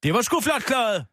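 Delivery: high, triumphant self-congratulation; pleased crowing after a coup; elated. Real, not acted. Celebrating the deal just made.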